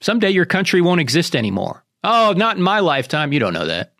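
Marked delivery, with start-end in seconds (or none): none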